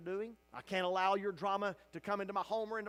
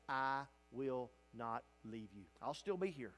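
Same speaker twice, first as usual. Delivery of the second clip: tender promise; 6 semitones lower, 5 dB quieter than usual. Acted.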